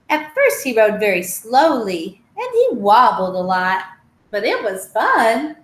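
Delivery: lively storybook read-aloud with voiced animals; reading to children. Teacher reading a picture book to children, lively. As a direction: tell the tale brightly to little listeners.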